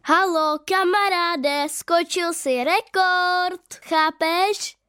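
put-on a boy's excited shout to a friend, stretched out; calling out. A narrator's reading. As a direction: call to him across the field, slowly. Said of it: "Volá na něj ten kluk".

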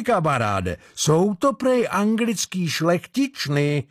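high, leering sailor banter; lewd sailors. A narrator's reading. Sailors behind the door joking about captive women, lewd. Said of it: "Oplzle se zasmál hlas".

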